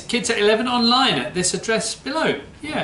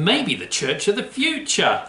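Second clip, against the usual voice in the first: ordinary talk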